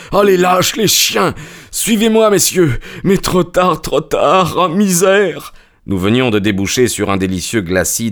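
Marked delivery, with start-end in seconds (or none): 0.1-5.5 anguished fury. A narrator's reading. Stranger curses, despairs, runs.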